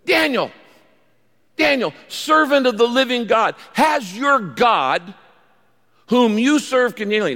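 high, loud, pleading call to Daniel; anguished cry. A narrator's reading. King calls to Daniel in the lions' den, anguished cry. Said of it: "in an anguished voice".

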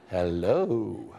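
low, soft hello that swoops up and falls; deep, drawn-out hello. Shown as a demonstration. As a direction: stretch hello out, low and sliding. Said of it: "all the ways you can say hello".

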